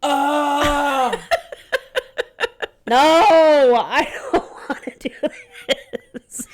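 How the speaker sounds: high laughing dread; giggling no, I can't; laughing groan. Real, not acted. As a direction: laugh in horror at the idea. Dreading the play date.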